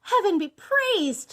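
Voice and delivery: high, loud, drawn-out relief; joyful exclamation. A narrator's reading. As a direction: cry out in thanks. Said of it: "she exclaimed a moment later".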